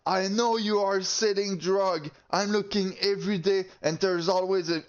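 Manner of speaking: high-pitched, insistent mock accusation; imitating angry accuser. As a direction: mimic an aggressive man hurling accusations. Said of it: "he screams, starts saying that".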